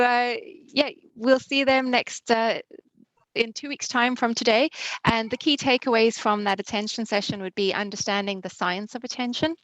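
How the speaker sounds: ordinary talk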